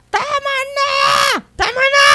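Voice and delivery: very high, loud repeated shriek; possessed shout. Retold or imitated. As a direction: shriek it over and over like a demon. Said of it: "Maraming beses".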